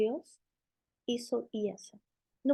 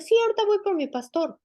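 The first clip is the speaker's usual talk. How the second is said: mother's reply, higher and louder; eager mother; 7 semitones higher, 5 dB louder, faster than usual. Retold or imitated. Mother in the testimony promising to fetch the pastor, higher and louder. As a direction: answer brightly like a determined mother.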